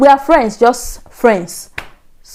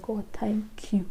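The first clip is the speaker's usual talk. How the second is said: slow, very soft whisper; hushed, unvoiced sigh of gratitude; whispered relief; 16 dB quieter, slower than usual. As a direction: whisper a private prayer of thanks. Private prayer of relief, retold.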